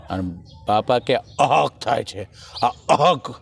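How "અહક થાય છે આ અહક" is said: put-on old villager booming the word અહક, a little louder; loud old man. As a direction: bellow અહક like a rustic grandpa. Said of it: "જોરથી બોલ્યા ને".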